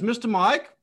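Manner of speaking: put-on high, loud questioning child; child asking. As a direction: ask sweetly before shooting. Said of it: "looks at me and goes".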